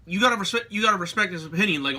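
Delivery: normal talking voice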